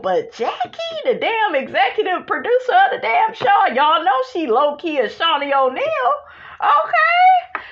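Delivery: high, laughing incredulous rant; laughing disbelief. Real, not acted. Laughing in disbelief to viewers about Jackie's status. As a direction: crack up over who she cursed at.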